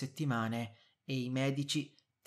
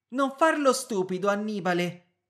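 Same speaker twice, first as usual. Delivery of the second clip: sharp, higher and louder cut-off; curt reproach; 8 semitones higher, 9 dB louder than usual. A narrator's reading. Wife cuts off her stalling husband, curt and sharp. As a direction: snap at him to stop playing dumb. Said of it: "in tono reciso".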